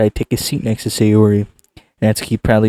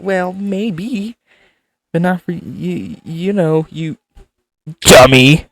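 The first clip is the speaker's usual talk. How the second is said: put-on tsundere girl voice; 7 semitones higher, 6 dB louder, much slower than usual. Voicing Natsuki tsundere.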